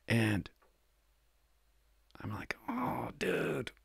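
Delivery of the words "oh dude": embarrassed whisper. Retold or imitated. Caught by the cop, embarrassed.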